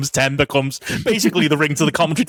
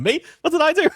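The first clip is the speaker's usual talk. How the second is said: very high, squeaky mock innocence; imitating a cartoon character's "who, me?"; Lenny impression. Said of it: "Lenny going".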